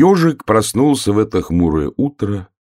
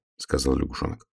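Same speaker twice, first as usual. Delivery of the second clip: ordinary delivery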